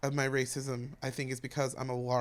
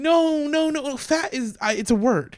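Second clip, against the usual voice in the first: higher and louder, hurried no-no-no; flustered protest; 11 semitones higher, 8 dB louder, slower than usual. Retold or imitated. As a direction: imitate people rushing to reassure. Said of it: "will be like".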